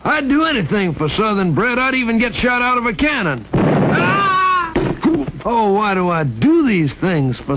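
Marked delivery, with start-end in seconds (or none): 3.5-4.3 high yelp. Acted. Shot out of a cannon.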